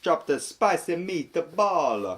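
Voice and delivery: high, slow, hammed-up Italian mobster accent; mock Italian mobster. Playing a cartoon mobster for laughs, hammed-up Italian accent.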